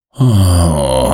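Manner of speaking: long, drawn-out sigh; deep sigh. A narrator's reading. Sleepless gnome despairing of ever sleeping, deep sigh. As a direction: heave a heavy, falling sigh. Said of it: "Er seufzt noch tiefer als Mieze".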